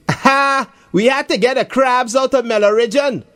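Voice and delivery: put-on high, jeering boy's voice; mock him from above; lightly voiced schoolboy taunt. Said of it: "Jason says in a mocking tone".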